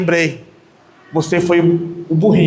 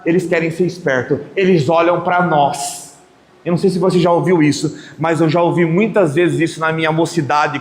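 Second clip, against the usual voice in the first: ordinary talk, nothing marked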